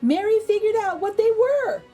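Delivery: ordinary delivery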